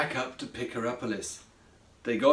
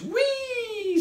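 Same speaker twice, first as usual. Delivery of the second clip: very high, gleeful squeal; drawn-out squeaky "whee"; squeaked whee. A narrator's reading. Craig spinning round in the bottle, gleeful squeak. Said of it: "squeaked Craig".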